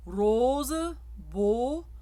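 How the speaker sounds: rose and beau with a closed, rising O. Shown as a demonstration. Coach demonstrating the closed O to singers. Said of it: "is your closed O".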